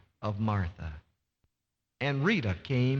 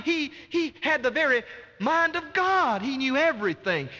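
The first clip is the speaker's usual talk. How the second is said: rising, ringing pulpit voice, much higher; preaching at full force; 10 semitones higher than usual. Acted. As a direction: build the fervour till it peaks on God. Preacher building to a climax.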